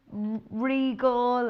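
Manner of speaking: telephone voice. Shown as a demonstration. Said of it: "makes me put my telephone voice on".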